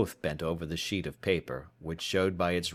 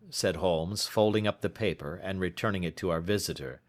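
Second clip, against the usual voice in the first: plain reading voice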